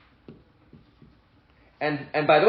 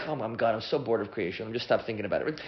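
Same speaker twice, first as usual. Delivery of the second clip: bored; 8 semitones lower, 11 dB quieter, faster than usual. Retold or imitated. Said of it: "there's no moment Hashem is like".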